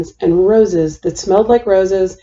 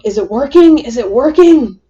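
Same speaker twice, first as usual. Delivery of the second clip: louder, higher call through a door; yelled question; 3 semitones higher, 4 dB louder than usual. A narrator's reading. Mother outside the bathroom door calling in, anxious yell. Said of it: "stood outside the door and yelled".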